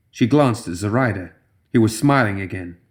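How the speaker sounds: usual speaking voice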